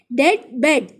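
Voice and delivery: डेड and बेड with one short "e"; same sound. Shown as a demonstration. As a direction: rhyme डेड neatly with बेड. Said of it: "इन दोनों की जो sounds हैं वो same हैं". (डेड और बेड की तुक मिलाकर)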